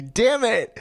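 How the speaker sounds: high, loud frustrated cry; real annoyed exclamation; exasperated outburst. Real, not acted. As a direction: blurt it out in disbelief. Reacts while laughing at text.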